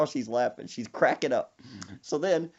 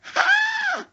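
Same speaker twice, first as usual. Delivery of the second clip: high shrieking laugh; real burst of laughter; 5 dB louder than usual. Real, not acted.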